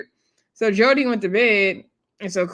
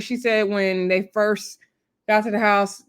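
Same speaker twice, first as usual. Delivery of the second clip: ordinary delivery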